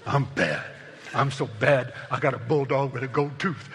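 put-on tough gang kid. Preacher mocking a boastful gang kid, a hint of swagger. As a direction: brag like a young gang leader. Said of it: "they think they're always having to prove themselves".

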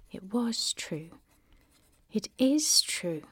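breathy whispered confession. Acted. Reading Lee's letter, whispering the confession. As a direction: whisper it like a secret.

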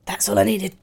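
usual speaking voice

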